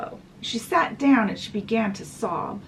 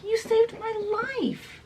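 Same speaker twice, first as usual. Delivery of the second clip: awed gratitude; 13 semitones higher than usual. Retold or imitated. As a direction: say it high, as one just rescued. Rescued boy thanks princess.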